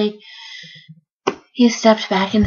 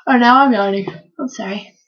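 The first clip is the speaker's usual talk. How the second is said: plain reading voice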